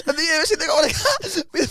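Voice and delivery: ordinary talk, nothing marked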